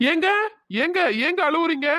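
high-pitched calling after her; concerned call. Acted. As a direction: try to catch a crying stranger's attention. Calls after the crying girl.

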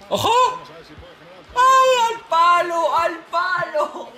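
high startled cry, then laughing; surprised shout. Real, not acted. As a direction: yelp as the ball hits the post. Shot hits the post.

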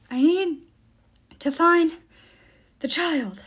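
high, broken into short winded bursts; panting. A narrator's reading. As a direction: push out the words between breaths. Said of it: "panted the creature".

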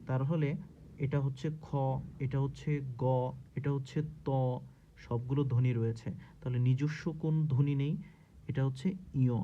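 ordinary delivery

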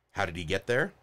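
ordinary talk, nothing marked

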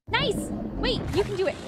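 ordinary delivery

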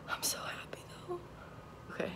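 soft, breathless whisper of joy; happy whisper. Real, not acted. After the positive test, a happy whisper.

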